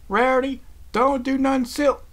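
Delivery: high, loud, drawn-out call; alarmed warning. A narrator's reading. Applejack stopping her friend leaping in, shouting a warning. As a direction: shout a warning, then get cut off.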